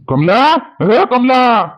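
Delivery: long, drawn-out shout of a name; calling out loudly. Acted. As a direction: holler for her, pitch climbing high. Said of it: "काय होय गड्या एवढं ओरडणं".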